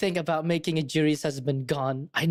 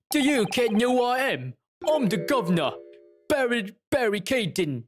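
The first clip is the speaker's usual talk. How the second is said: hammed-up Cockney governor, pitched higher; Cockney accent; 5 semitones higher than usual. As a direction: announce yourself as a Cockney governor. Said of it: "gonna give him a Cockney accent".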